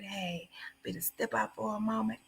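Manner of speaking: hushed, low, discreet murmur; very soft and low, as if slipping away unnoticed; imitated whisper. Friend mimicking the man quietly excusing himself from the bill, hushed whisper. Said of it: "He went over and he whispered".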